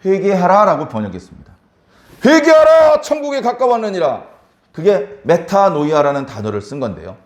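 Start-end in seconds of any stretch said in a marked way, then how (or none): 2.2-4.2 proclaimed scripture. Acted. Proclaiming Jesus' call to repent.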